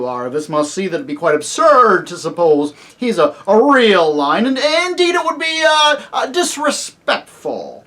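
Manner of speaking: loud, emphatic, blustering lecture; pompous horse Bree. Acted. Bree holds forth in story.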